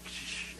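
soft quick shushes; imitated parent's soft shh; hushing. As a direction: quietly shush a baby.

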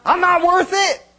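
high, loud wounded wail; mock-offended wife whining about cheap ice cream; hammed-up hurt wife. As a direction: play the wounded wife, way over the top. Wife's hurt reply in quarrel.

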